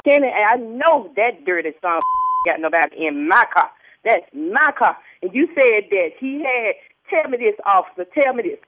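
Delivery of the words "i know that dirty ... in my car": usual speaking voice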